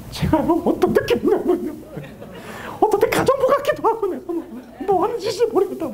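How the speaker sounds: a wife's small, high, half-laughing confession; voice shrinking. Acted. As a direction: let the voice shrink as she opens up. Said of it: "그때부터 갑자기 목소리가 쑥 들어가".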